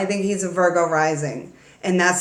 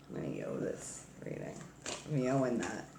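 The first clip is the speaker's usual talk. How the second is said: whispered aside; 10 semitones lower, 17 dB quieter than usual. Real, not acted. Muttered aside to herself.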